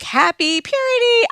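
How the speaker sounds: high, loud cheery greeting; everybody cheering her on; cheerful crowd. Retold or imitated. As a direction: chirp it like a happy crowd.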